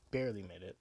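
normal talking voice

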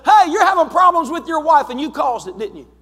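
high and loud, blurting it out boldly; bold accusation. Retold or imitated. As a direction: confront the stranger head-on, loud and sure. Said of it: "But there was a boldness".

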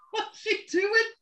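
high squeak through suppressed laughter; laughing disbelief. Retold or imitated. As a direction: ask it while trying not to crack up. Stifling laughter at coach's siren.

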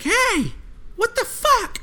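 high, shrill-pitched angry woman shouting; woman yelling. Retold or imitated. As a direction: squeal it out like an outraged woman. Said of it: "she's like".

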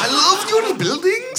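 high, slow attempt at a Bristol accent gone Dutch; bad comic accent, half Dutch, half Welsh; botched Dutch-Welsh accent. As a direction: put on a wandering Dutch-by-way-of-Wales accent. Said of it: "that was quite a sort of Dutch".

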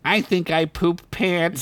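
a dim little creature, slowly worried; Squib's voice. Acted. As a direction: Squib confesses to Ugla. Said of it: "Squid said".

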